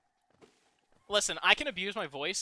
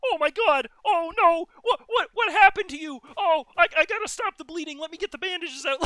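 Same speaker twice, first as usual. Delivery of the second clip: hammed-up panic; 12 semitones higher, 7 dB louder than usual. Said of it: "the person that's holding them says".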